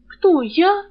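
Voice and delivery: high, loud startled squeak; frightened. A narrator's reading. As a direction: jolt in fear. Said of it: "перепугалась Рыска".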